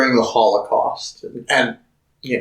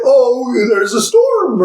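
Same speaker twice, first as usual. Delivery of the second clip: high, bright fake reassurance; sweet teacher voice; 10 semitones higher, much slower than usual. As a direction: cover the truth sweetly for kids. Voicing teacher to children.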